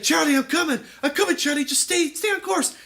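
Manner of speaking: high, loud urgent shouts; urgent calling out. Acted. Rescuer calling to Charlie in the ball, urgent calling. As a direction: play a frantic rescuer calling across.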